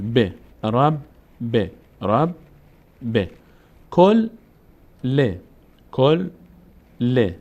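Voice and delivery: رب then ب as "rab, be", کل then ل as "kol, le"; split syllables. Shown as a demonstration. Teacher reading the doubled letter twice, syllable by syllable. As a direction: pause between closed syllable and voweled letter.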